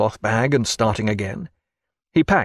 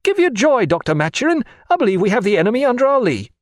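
high, loud, fast with eager joy; excited cry; 11 semitones higher, 3 dB louder, faster than usual. Acted. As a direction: burst out with delighted hope.

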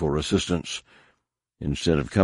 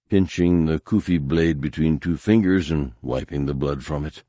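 ordinary delivery, nothing marked

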